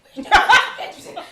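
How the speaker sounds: high, very loud and slow, laughing; mocking family call. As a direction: drag out a mock nosy family yell. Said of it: "and everybody was like".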